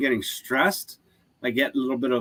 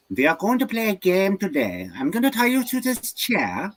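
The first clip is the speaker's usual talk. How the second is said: high-pitched hammed-up German accent; old German scientist; 10 semitones higher, 3 dB louder than usual. Old German scientist threatening a captive in a chair, theatrical German accent. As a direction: play a menacing German interrogator for laughs. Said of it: "like an old German scientist".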